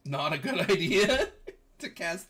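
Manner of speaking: loud, laugh breaking into the words; amused, chuckling caution; laughing warning. Real, not acted. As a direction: warn them through a laugh. Game master laughs while warning player.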